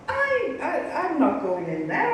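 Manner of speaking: high-pitched, balking retold protest; indignant refusal. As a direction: dig your heels in, acting out indignation. Refusing to enter prison cell.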